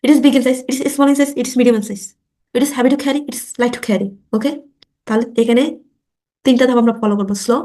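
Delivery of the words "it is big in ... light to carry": rattled off quickly; teacher modelling fast speech. Shown as a demonstration. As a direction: rush through the drill lines. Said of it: "একটু দ্রুত বলার চেষ্টা করবো".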